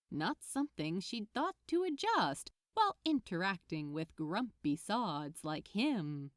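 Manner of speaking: ordinary delivery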